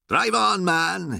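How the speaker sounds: high-pitched impatient order; sudden fury. A narrator's reading. Poacher to the sergeant in the car, sudden fury. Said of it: "he said with sudden fury".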